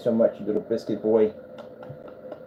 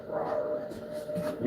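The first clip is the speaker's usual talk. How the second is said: playful monster rawr; 9 semitones lower, 12 dB quieter than usual. Pretend. Mock Godzilla roar at cat.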